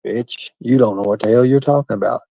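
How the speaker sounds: ordinary talk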